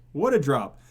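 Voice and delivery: usual speaking voice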